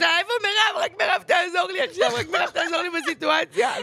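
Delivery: high, loud pleading shout; mock drunk shout. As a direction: call out for her mom, half-crying. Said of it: "ואז אני עושה לה בקול". (צעקה שיכורה מבוימת)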